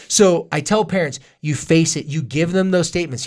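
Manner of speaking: usual speaking voice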